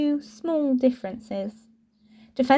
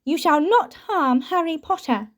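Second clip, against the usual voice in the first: a lightly raised house elf voice, defiant; an elf standing his ground, a bit higher; house elf's defiance; 5 semitones higher than usual. A narrator's reading. Elf defends Harry from Malfoy.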